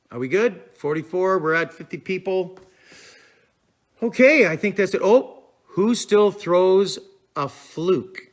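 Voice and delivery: ordinary delivery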